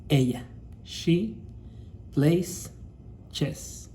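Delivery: slow, with every word drawn out; slow read-aloud. Shown as a demonstration. Teacher reading the example aloud to students, slow and clear. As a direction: read the example slowly for the class. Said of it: "Vamos a leer ese ejemplo".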